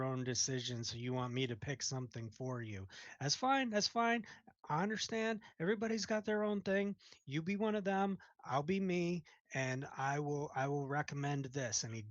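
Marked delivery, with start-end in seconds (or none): 3.2-9.2 mocking, higher and fast; sarcastic cook. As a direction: brush off the customer with mock patience. Cook mocks indecisive customer.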